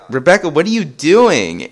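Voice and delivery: high, loud, incredulous question; exasperated disbelief. Retold or imitated. Steve confronts Rebecca interrupting his game, exasperated. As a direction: protest as if caught off guard.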